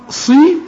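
"صي" only slightly thickened before the i vowel; least heavy sad. Shown as a demonstration. Teacher shows sad with kasra, slightly heavy. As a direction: keep the s of "صي" at its lightest heaviness. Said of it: "آخر درجات التفخيم".

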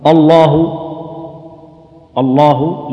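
الله with a thick, dark opening "a"; heavy hamza. Shown as a demonstration. Quran teacher showing the mistake to avoid in الله, heavy hamza. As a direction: make both the hamza and lam of الله heavy. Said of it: "مفخمة".

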